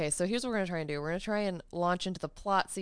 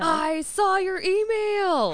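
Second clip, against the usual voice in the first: loud high slow excitement; excited; 13 semitones higher, 9 dB louder, much slower than usual. Real, not acted. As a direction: gush with excitement. Excited news.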